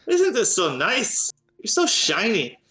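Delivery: high, delighted gnome cooing; gnome cleric Corky. Acted. Gnome cleric admires Clemens's trinkets, high and delighted. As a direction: gush in a high, swooping character voice. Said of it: "Clemens, and says".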